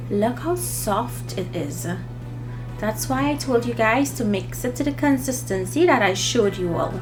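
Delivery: usual speaking voice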